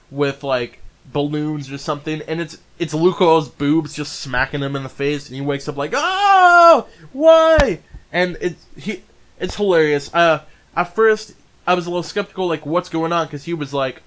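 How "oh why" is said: loud, high drawn-out cry; mock startled wail. Imitating a boy waking up from a dream, startled wail. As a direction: wail it out in exaggerated shock.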